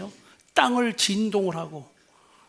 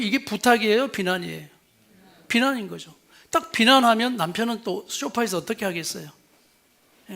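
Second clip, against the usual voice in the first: ordinary talk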